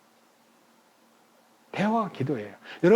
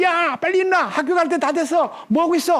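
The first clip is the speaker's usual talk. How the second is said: high-pitched scolding wake-up yell; imitated mother's yelling; 10 semitones higher than usual. Mother waking her two sons for school, yelling high. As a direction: holler like a mum at sleeping kids. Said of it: "막 소리를 지르는 거예요".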